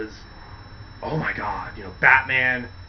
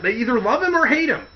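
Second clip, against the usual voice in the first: normal talking voice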